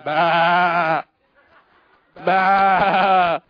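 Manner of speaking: low bleating "bah", like a sheep. Acted. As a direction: bleat like a stray sheep behind him. Sheep noises interrupting Saul.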